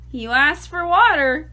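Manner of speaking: usual speaking voice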